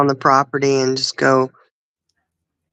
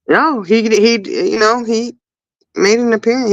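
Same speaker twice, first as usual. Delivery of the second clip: usual speaking voice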